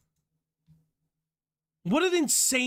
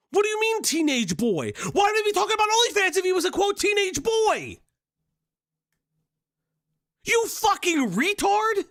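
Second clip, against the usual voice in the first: furious shout; 8 semitones higher than usual. Real, not acted. Streamer yelling back at the video's accusation, furious shout. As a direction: shout down an accusation you can't believe.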